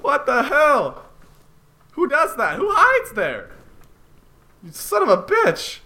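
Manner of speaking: outraged at the hidden enemy; frightened outrage. Real, not acted.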